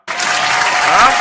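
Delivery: loud breathy huh. Real, not acted.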